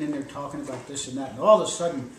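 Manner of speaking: plain reading voice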